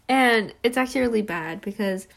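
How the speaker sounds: ordinary delivery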